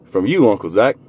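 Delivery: ordinary talk, nothing marked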